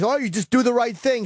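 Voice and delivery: high sing-song mock of well-meaning advice. Man mimicking people's glib advice, mock sing-song.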